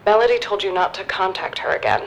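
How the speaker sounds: phone speaker. Acted. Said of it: "I'm putting this on speakerphone".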